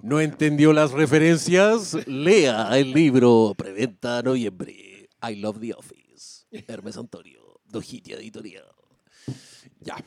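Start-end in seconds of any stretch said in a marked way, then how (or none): 0.0-8.6 promo ad read. Acted. Podcast host plugging the Office book, announcer voice. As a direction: sell the book like a radio spot.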